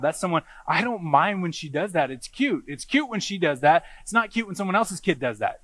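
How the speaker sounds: ordinary delivery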